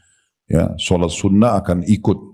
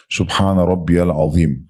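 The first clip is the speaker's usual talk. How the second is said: slow calm recitation; slower than usual. Shown as a demonstration. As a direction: recite it slowly with a pause after. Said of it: "Diam dulu".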